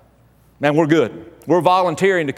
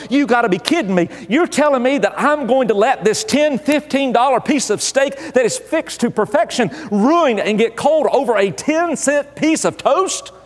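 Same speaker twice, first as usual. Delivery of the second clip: higher, exasperated disbelief; inner outrage; 6 semitones higher than usual. Retold or imitated. Husband fumes inwardly at waiting for bread, exasperated and higher. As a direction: let the frustration build as if you can't believe it. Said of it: "we're going".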